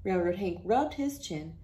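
ordinary delivery

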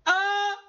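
very high mock "Ah!"; imitated scream. Recapper imitates the protagonist's scream, high mock yell. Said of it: "afectadas por su grito".

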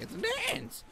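very high squeaky mock exclamation; mock falsetto outburst; retold squeal. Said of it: "I'm just like".

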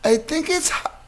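put-on high-pitched small child voice, hesitant then decided; five-year-old girl. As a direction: imitate a little kid thinking it over, then giving a verdict. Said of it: "his five-year-old daughter".

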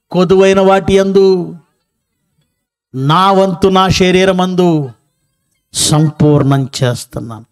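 slow, drawn-out verse reading; scripture reading. Acted. Preacher reads Paul's words to the congregation, slow scripture reading. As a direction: read the verse out deliberately, stretching the phrase endings.

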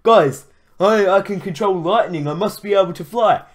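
a mock of a naive, boastful kid; mocking the hero. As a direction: mimic the hero's dumb boast. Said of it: "You just said".

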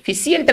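"फिशिएंट" with an extra i, fi-si-ent; wrong pronunciation. Shown as a demonstration. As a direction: split the end of "फिशिएंट" into si-ent. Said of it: "फिशिएंट नहीं". ("फिशिएंट" का गलत उच्चारण, फि-सि-एंट)

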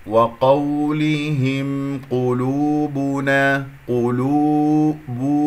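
قلوبنا said very slowly with the lips rounded from ق to ب; slow rounded demo. Shown as a demonstration. Teacher slowly modelling rounded lips on قلوبنا. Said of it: "من القاف إلى الباء مضموم الشفتين".

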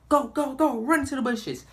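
imitating kids urging each other on; urgent kids. Kids racing for the egg, a mock urgent shout.

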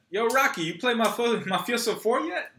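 high, eager, tripping over words; can't wait to ask, stumbling; hyper excitement. Acted. Joey asks Rocky about the game, hyper.

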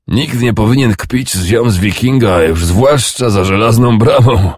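a sick old man's mocking laugh in his voice; laughter about to turn into a cough; laughing old king. A narrator's reading. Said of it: "Śmiech przeszedł w kaszel".

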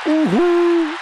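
real victory whoop; goal shout. Real, not acted. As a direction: let the joy burst out. Another goal scored.

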